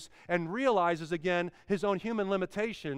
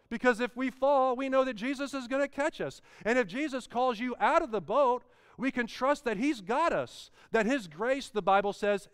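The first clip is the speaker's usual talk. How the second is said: uplifting preaching; 5 semitones higher than usual. Acted. Lifted reassuring close.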